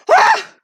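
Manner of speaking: a very high, loud happy squeal; excited shriek. Real, not acted. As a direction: squeal with delight. Triumphant drawing moment.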